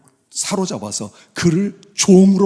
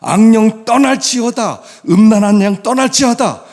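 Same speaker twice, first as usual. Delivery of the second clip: loud, high commanding declaration; preacher's ringing rebuke; forceful proclamation; 8 semitones higher, 9 dB louder than usual. Shown as a demonstration. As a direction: cast out the spirit with full-voiced authority. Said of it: "선포하는 거예요".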